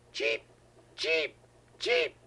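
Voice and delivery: chirps climbing very high; bird cheep. Acted. Grandpa voicing the scarlet bird, high chirps. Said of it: "And he said".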